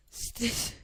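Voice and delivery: nervous laugh. Real, not acted. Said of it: "Sinirden gülüyorum".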